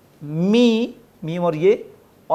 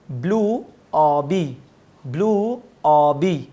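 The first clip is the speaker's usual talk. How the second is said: slow drill; slower than usual. Shown as a demonstration. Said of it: "एक बार जल्दी जल्दी repeat कर लिए".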